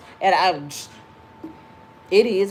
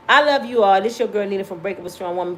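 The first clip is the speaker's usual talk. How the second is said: ordinary talk, nothing marked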